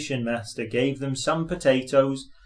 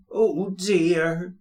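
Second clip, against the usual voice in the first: groaning; 6 semitones higher than usual. A narrator's reading. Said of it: "groaned Thomas".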